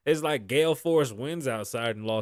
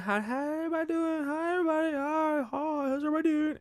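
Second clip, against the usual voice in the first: very high, sweet singsong greeting; high-pitched mock friendly lady; sing-song neighbor lady; 13 semitones higher, 3 dB quieter, faster than usual. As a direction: chirp it like an overly cheery neighbor. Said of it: "she's saying".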